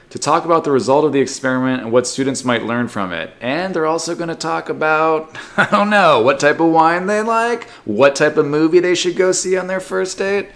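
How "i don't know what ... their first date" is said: giggly teasing. Real, not acted. Giggly speculation.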